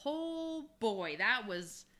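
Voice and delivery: usual speaking voice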